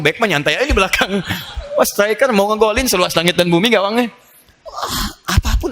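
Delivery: laughing through the joke. Real, not acted. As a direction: chuckle while you describe it. Jokes about heavenly football pitch.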